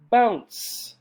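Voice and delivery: ordinary talk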